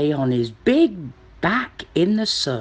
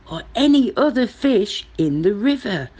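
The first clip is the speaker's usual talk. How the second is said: ordinary delivery, nothing marked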